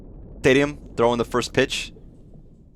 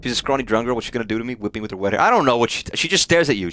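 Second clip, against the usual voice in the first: usual speaking voice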